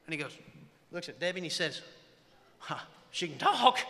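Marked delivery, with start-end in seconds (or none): 2.6-3.8 slow, high, astonished exclamation; loud amazement. Retold or imitated. Friend astonished that his buddy's new wife talks, loud mock amazement. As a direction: marvel loudly, drawing it out.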